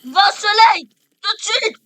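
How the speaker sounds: loud, high commanding yells; shouting orders. Real, not acted. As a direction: order them into the sun, loud. Ordering mobs into the sun.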